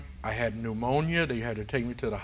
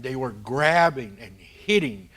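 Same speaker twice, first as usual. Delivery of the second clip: ordinary talk, nothing marked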